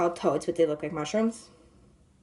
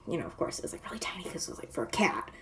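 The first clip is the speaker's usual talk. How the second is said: whispered aside; 4 semitones lower, 7 dB quieter, faster than usual. Real, not acted. Aside about the tiny tent.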